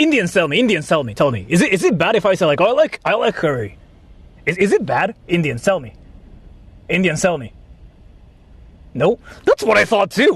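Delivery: usual speaking voice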